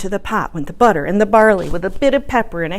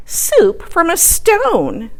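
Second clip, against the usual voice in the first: slow, higher voice of wonder; old lady voice; 8 semitones higher, 3 dB louder, much slower than usual. Acted. Little old lady marvelling, slow and high. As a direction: draw it out in amazement.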